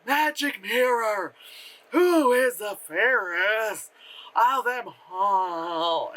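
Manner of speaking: slow, drawn-out stilted movie line; mock bad acting. Mocking an actress's wicked queen, stilted bad acting. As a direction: overact it with long stretched words. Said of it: "acting is like".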